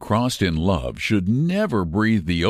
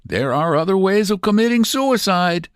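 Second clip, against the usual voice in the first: advising; 10 semitones higher, 3 dB louder than usual. A narrator's reading. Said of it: "advised Mexican".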